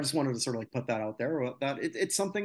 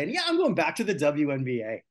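ordinary talk

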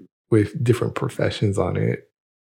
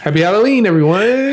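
high, loud Halloween cheer; excited greeting; 10 semitones higher, 5 dB louder, faster than usual. Acted. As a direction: sing it out bright and high.

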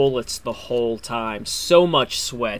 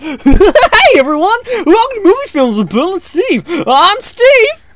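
silly clown; 11 dB louder, slower than usual. Acted. Podcast intro in goofy character voices.

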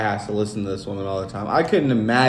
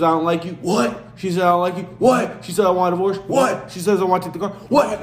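mock wife and husband; 5 semitones higher than usual. Host acting out his wife's demands and his shocked replies. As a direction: trade lines with a shocked squeaky What.

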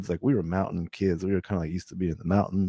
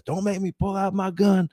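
higher-pitched, menacing retold threat; threatening driver; 10 semitones higher than usual. Driver warns the remaining passengers not to flee, threatening. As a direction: imitate an armed driver giving orders.

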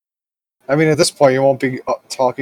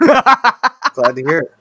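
laughing; 11 semitones higher, 6 dB louder, much slower than usual. Real, not acted. Laughing reply.

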